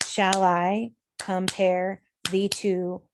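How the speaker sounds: slow, paired syllables with pauses, splitting "summer's"; clapped-out rhythm. Shown as a demonstration. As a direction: break "Shall I compare" into slow two-beat chunks. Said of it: "clap out the rhythms".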